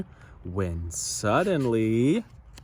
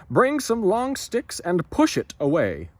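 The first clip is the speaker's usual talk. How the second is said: higher, louder command; shouted order; 8 semitones higher, 6 dB louder than usual. Acted. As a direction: call out to push the whale. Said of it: "shouted the harbor master".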